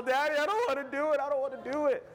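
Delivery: put-on high child's voice, tearful protest; crying little boy. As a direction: wail it like a toddler on a bike. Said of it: "and he's crying, so emotional".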